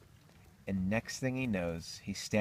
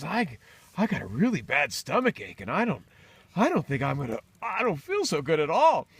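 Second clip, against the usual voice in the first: higher, mounting complaint; acted queasy distress; 7 semitones higher, 5 dB louder than usual. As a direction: build from uneasy to loud, high bellyache. Acted complaint of stomach ache.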